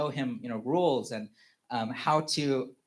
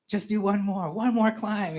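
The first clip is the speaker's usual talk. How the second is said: higher-pitched acted-out urging; coaxing encouragement; 7 semitones higher, 4 dB louder than usual. Dad asks son to climb before home, retold coaxing.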